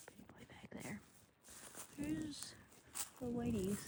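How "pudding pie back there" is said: "Pudding pie back there" whispered softly. Real, not acted. Whispering a pet name to a calf in the barn. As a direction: breathe "Pudding pie" so the calf isn't startled. Cooing at the calves.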